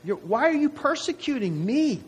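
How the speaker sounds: high, pleading question from Jesus; Jesus asking Saul. Retold or imitated. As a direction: ask why he hurts you, voice lifted high. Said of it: "what does Jesus say to him".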